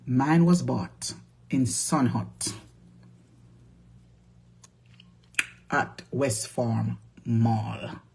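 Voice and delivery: drawn out slowly, word by word; slow insistence. Real, not acted. To viewers, slowly insisting on where she shopped. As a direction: spell out where you bought it.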